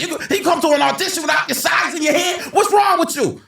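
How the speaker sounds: high, shouted mock scolding from a director; Spike Lee. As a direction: chew out an unprepared actor, high and loud. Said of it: "Spike looks at me and goes".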